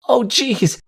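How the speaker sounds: put-on high-pitched startled child's squeak; little colt's high yelp of alarm. A narrator's reading. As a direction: squeak in dismay. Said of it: "he squeaked".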